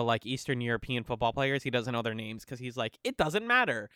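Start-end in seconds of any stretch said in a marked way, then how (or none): 3.0-3.9 raised-pitch brush-off as the principal; dismissive. Acted. Explaining why the principal skips players' names, dismissive. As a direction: wave it away, voice jumping up.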